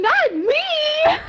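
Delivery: drawn-out, high squealed protest; high mock whine. Protesting to Axel after the smack, mock whine. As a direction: wail it in mock indignation.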